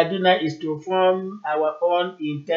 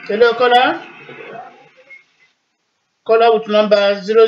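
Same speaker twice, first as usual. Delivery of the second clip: loud, raised hello; calling out; 3 semitones higher, 4 dB louder than usual. Real, not acted. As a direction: call out to a silent line. Host calling silent line.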